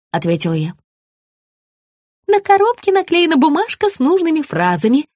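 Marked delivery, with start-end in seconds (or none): 2.3-5.1 high-pitched flustered helpfulness; fussing. A narrator's reading. Margarita hurries to explain the text, fussing. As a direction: fuss in a raised voice. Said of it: "засуетилась Маргарита".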